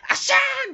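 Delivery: high squealing asshaa like a felled mutant; mutant monster's defeat cry. Retold or imitated. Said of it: "突然変異種のやられ方の声みたいな". (やられた怪人のような甲高い叫び)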